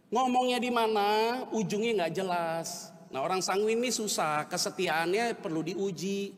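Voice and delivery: ordinary talk, nothing marked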